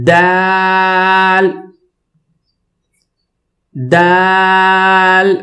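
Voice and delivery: دال with a hard d and long held vowel; firm articulation. Shown as a demonstration. Teacher models for students, firm drawn-out دال. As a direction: strike the d of دال, then sustain it level. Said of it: "ذرا سختی کے ساتھ پڑھیں گے".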